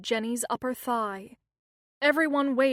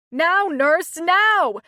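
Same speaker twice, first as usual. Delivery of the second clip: loud, higher, desperate yell; scream; 7 semitones higher, 11 dB louder, much slower than usual. A narrator's reading. Angela screaming at the nurse to hurry, loud scream. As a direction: scream at her to move. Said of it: "screamed Angela".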